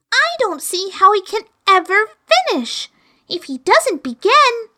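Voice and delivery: put-on high, curious girl's voice; little girl Alice. Alice questioning the Griffin in a high girl's voice. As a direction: ask it as a puzzled young girl.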